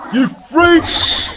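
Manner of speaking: high, loud shout of insult; angry yell. Acted. Oster yells at the jeering aliens, loud high shout. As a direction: hurl the insult at them.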